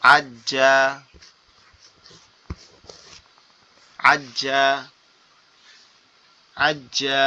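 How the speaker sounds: আজ্জা with a held doubled j; teacher models আজ্জা for students; Arabic letter drill. Shown as a demonstration. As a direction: press and hold the j in আজ্জা. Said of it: "হামজা জিম জবর".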